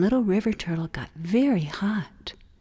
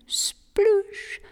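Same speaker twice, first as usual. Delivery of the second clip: splash; 12 semitones higher than usual. Acted. Splash sound effect.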